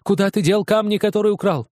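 beside yourself with rage; father accusing his son of theft; raging. Acted. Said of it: "вне себя от ярости".

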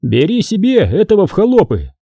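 high-pitched, curt order; restrained commanding cry; mild shout. A narrator's reading. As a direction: call out an order across the crowd. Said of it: "Бренко крикнул".

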